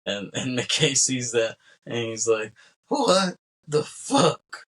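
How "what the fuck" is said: high, drawn-out, louder shocked question; imitating a shocked boss; stunned disbelief. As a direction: stretch it out in sheer disbelief. McKay shocked by the killing.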